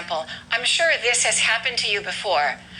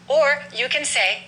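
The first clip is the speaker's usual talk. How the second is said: ordinary talk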